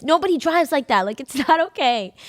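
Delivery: loud, high mock scold; hammed-up disapproving dad. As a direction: imitate him nagging, laughing through it. Mocking dad's complaint about driving.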